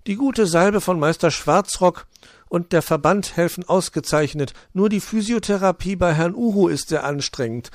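high chirpy little bird. Acted. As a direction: chirp a greeting. Bird reports on recovery.

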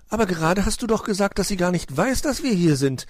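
put-on high, insistent young nephew; probing. A narrator's reading. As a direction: press the point with childlike persistence. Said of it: "bohrte Weißohr nach".

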